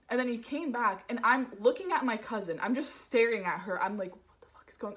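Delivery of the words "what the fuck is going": barely voiced, very soft whisper; mouthed whisper. Mouthing to cousin across the aisle, voiceless whisper. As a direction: mouth it with almost no sound. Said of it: "I'm just kind of mouthing to her".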